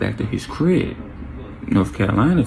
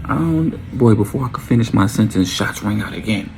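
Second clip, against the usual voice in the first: ordinary talk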